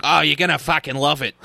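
mock Aussie accent; Australian guy. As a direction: imitate an Australian fan's accent. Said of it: "some Australian guy is gonna be like".

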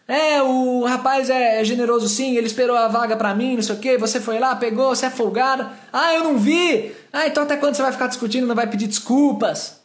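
high-pitched, heated mock bickering; retold arguing. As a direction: argue back and forth, imitated. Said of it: "começa a discutir com a mulher". (bate-boca imitado, em tom agudo)

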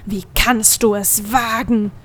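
slow, menacing goat demon; lightly voiced bellow of outrage; mild roar. A narrator's reading. Goat demon bellows at the girl, mildly voiced. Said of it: "brüllte er".

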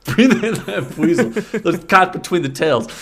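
high, loud holler through laughter; really loud. Pretend. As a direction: yell it out gleefully, cracking up. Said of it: "I said that really loud".

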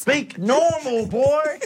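high, slow hammed-up southern scolding; southern preacher ordering a boy, drawn out and high; southern accent. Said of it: "the fact you did a southern accent".

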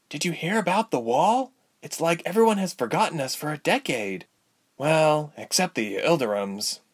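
put-on high girlish voice; worried young patient, falling away at the end; earnest young Ilse. A narrator's reading. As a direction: confide troubles in a young, lifted voice.

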